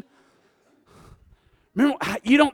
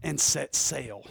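soft, slowing words of a man departing; hushed farewell, almost breathed; 7 semitones lower, 11 dB quieter than usual. Acted. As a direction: let it drift away quietly. Preacher voices Paul departing softly.

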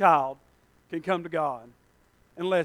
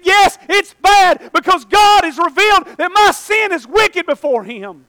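put-on shouting the boy's words high and loud; loud, higher ringing cry, slowing on wicked; boy's shouted plea; 9 semitones higher, 10 dB louder than usual. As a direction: cry it out as the convicted boy. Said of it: "He said".

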